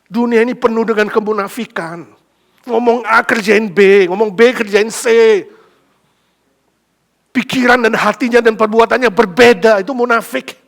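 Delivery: loud, fervent sermon voice; preaching at full force. Acted. Preacher denouncing worldly hypocrisy, loud and fervent. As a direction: drive the point home hard.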